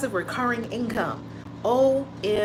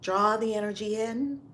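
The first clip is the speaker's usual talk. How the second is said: guided breathing; 4 dB quieter than usual. Acted. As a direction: lead them calmly through each breath. Leads a breathing exercise.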